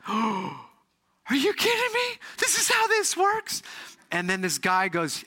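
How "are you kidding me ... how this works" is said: high, slow, amazed cry of disbelief; stunned disbelief. Retold or imitated. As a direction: gasp it out, can't believe it. Stunned reaction to the miracle.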